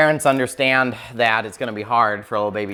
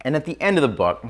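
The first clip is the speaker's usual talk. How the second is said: ordinary talk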